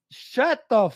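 mock-high scornful comeback to critics; dismissive retort. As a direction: brush them off in a mock high voice. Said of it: "and we're like".

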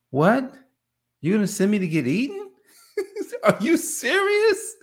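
high-pitched, mock-outraged disbelief; acted incredulity. Acted reaction to being sent among wolves.

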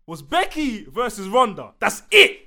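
loud, high exasperated outburst; real heated frustration, punched phrase by phrase; getting mad. Real, not acted. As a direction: hammer it out slowly, really fed up. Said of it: "I'm sorry, I'm getting mad".

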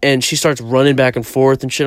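ordinary talk, nothing marked

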